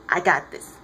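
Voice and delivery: low "I got this", contained; low assurance. Retold or imitated. Clark telling Diana to hold back, low assurance. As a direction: keep "I got this" down in pitch.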